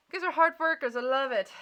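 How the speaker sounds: high, loud gushing praise; bursting with proud enthusiasm; real delight. Real, not acted. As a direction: cheer them on, thrilled for them. Reader delighted for the audience.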